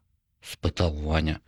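ordinary delivery

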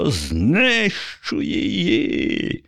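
very high, very slow monstrous cry; furious zombie yelling in a strange voice; unnatural scream. A narrator's reading. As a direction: shriek it out like a raging zombie. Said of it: "закричав не своїм голосом".

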